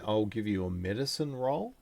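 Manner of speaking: ordinary talk, nothing marked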